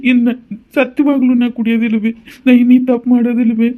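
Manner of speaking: earnest, high-pitched vow; pleading promise. Acted. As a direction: beg her to believe you. Son promises mother to reform.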